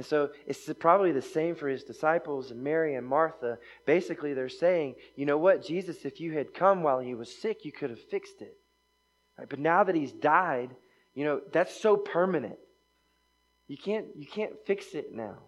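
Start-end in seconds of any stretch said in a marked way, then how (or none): 5.2-15.4 louder, pleading complaint to Jesus; raised voice of a disappointed follower; voiced complaint. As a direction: plead it like a grieving friend. Voicing grieving disciples' complaint.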